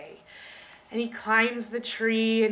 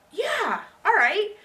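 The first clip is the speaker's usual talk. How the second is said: put-on boy's agreement; 10 semitones higher, 7 dB louder, much slower than usual. Boy agrees in retold story.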